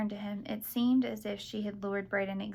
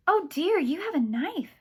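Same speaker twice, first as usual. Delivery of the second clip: put-on sweet Charleston accent; 9 semitones higher, 7 dB louder than usual. A narrator's reading. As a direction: feign dismay in a sugary Charleston accent. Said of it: "in her sweet Charleston accent".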